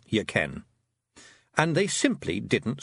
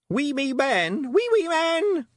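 put-on much higher gleeful toddler shout; 4 dB louder, slower than usual. A narrator's reading. Wentworth delighted by the Feegles, gleeful toddler shout. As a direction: squeal with delight. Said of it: "shouted Wentworth gleefully".

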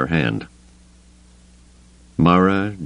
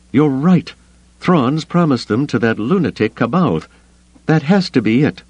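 higher, tense woman's voice; Mara's voice; 8 semitones higher than usual. Acted. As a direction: voice Mara, urgent and tense. Mara voiced.

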